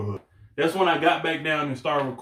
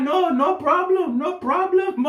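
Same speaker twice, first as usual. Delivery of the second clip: mock fisherman; 3 dB louder than usual. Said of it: "They're like".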